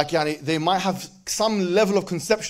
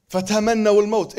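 fast, louder chanted verse; Arabic verse recited; Quran recitation; 3 semitones higher, 5 dB louder, much faster than usual. Acted. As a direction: recite it in tajweed style.

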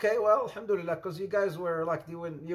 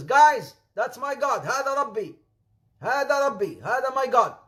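excited proclamation; 7 semitones higher, 5 dB louder than usual. Acted. Abraham proclaims the sun god.

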